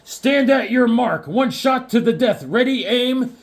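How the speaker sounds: high, loud, drawn-out announcer call; dramatic title read. Acted. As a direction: read the cover lines like a showdown challenge.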